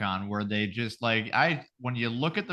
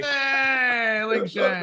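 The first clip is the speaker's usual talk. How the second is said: drawn-out, high cheer; mock welcome cheer; 5 semitones higher, much slower than usual. Host welcomes the imaginary guest, mock cheering. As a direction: cheer like a studio crowd greeting a guest.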